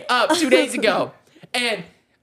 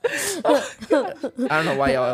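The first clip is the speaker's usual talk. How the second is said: slow, lower, shaking with laughter; laughing mock offence; 6 semitones lower, 3 dB quieter, much slower than usual. Real, not acted. He pretends offence while friends laugh. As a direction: act hurt while laughing. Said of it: "it's not that funny".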